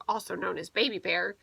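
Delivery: ordinary delivery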